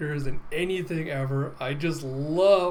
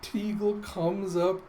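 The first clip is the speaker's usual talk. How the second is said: usual speaking voice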